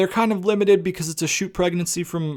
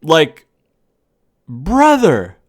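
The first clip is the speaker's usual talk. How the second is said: 1 exasperated shout; 7 dB louder, much slower than usual. Real, not acted. Exasperated outburst at WWE booking.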